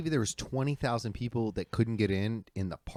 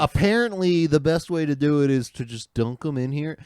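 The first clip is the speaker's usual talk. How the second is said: louder, higher mock imitation of her; mocking quote; 5 semitones higher, 8 dB louder than usual. Ranting about a woman's Oreo video, mockingly imitating her. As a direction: imitate her, pitched up and loud. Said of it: "she's like".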